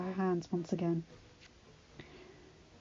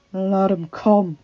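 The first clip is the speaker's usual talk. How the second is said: snarled; 10 dB louder, much slower than usual. A narrator's reading. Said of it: "Adam snarled".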